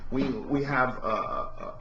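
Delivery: usual speaking voice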